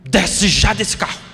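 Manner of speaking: slow, firm, stern command to the son; father putting his foot down; a slow stern order, firm but not shouted. Retold or imitated. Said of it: "com a cara bem brava".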